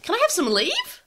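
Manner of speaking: "Can I have some leave?" with the pitch rising high on "leave"; high rising end. Shown as a demonstration. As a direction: end "leave" on a high Australian-style upturn. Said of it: "they finish with a high end point".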